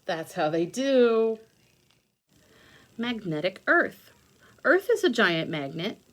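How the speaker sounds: ordinary talk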